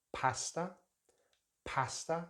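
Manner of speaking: pasta with short a as in cat; British pasta. Shown as a demonstration. Teacher modeling British pasta. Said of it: "in British English".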